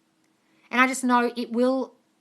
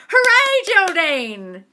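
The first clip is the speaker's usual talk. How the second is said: excited cheer; 9 semitones higher, 9 dB louder, much slower than usual. Real, not acted.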